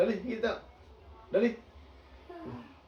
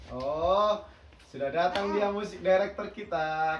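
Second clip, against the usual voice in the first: ordinary delivery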